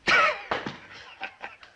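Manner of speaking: very high delighted laugh; pleased laugh at his own joke. Acted. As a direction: burst out in a high cackle. Laugh after boasting remark.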